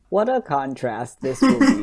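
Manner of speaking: words bubbling through real laughter; laughing while talking. Real, not acted. Answering a co-host's short review, laughing while talking. Laughing about the contrast in opinions.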